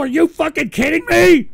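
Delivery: loud, high disbelieving yell; angry outburst. Real, not acted. Streamer yelling at the fail troll result. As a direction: blow up in disbelief.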